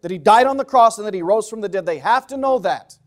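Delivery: loud, emphatic sermon delivery; forceful preaching. Real, not acted. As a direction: hammer each point home to the congregation.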